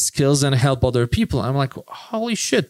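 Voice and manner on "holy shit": amazed exclamation. Retold or imitated. Retold moment of amazement.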